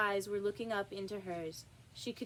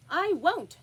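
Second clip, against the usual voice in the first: higher, louder refusal; defiant Alice; 8 semitones higher, 8 dB louder than usual. Acted. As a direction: fling back a defiant refusal. Alice defies queen.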